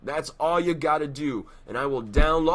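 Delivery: usual speaking voice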